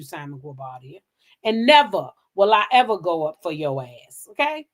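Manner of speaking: ordinary delivery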